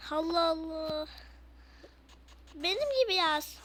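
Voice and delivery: ordinary talk, nothing marked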